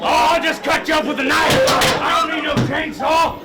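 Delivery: high, loud psycho shout; menacing slasher. Acted. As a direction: scream threats like a maniac. Scare actor menacing guests.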